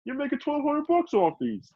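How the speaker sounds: high mocking voice; imitating Bam. Friend imitating Bam refusing to pay, high mock voice. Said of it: "Bam's like".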